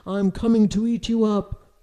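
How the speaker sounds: loud, menacing troll threat; storybook troll, loud and threatening; troll growl. A narrator's reading. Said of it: "growled the troll".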